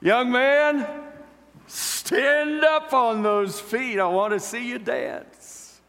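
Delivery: put-on high, slow ringing call to the young man; commanding call. Preacher calling the boy to stand and dance, ringing high. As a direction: call him up onto his feet, slow and grand.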